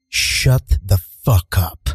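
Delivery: low, slow and deliberate; silent-stare contempt. Retold or imitated. Dog owner staring down a yelling stranger, low slow contempt. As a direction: drop the pitch and space out each word. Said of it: "I just stare at him".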